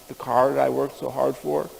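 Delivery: ordinary talk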